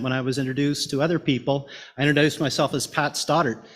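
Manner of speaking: ordinary talk, nothing marked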